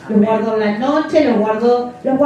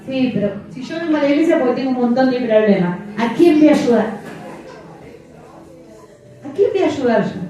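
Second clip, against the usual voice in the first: doubting churchgoer; faster than usual. Retold or imitated. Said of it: "¿No pensó eso".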